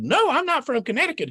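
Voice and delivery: high, pleading little rabbit voice; begging in a high squeaky voice; Rabbit's voice. Acted. Rabbit begs Fox for a fish, high and pleading.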